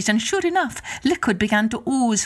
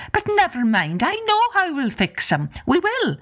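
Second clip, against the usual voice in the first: high, lilting Irish wife; Una's voice; 10 semitones higher than usual. Acted. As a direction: sweetly put off the visitor in a high voice. Una voiced in story.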